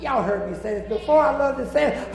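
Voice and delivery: loud, rising, whooping sermon cadence; full-force preaching. Real, not acted. Preacher whooping the rapture to his congregation. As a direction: preach it to the rafters.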